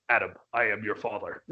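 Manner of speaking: a quick lightly voiced Vader joke; Darth Vader parody. Star Wars parody quote.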